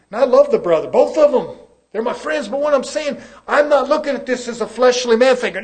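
impassioned pulpit delivery; forceful preaching. Real, not acted. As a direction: preach it loudly with urgency.

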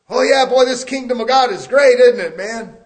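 loud, high sarcastic cheer; mock the easy-gospel preacher, loud and high; mock cheerful. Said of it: "I'm being careful how I speak here".

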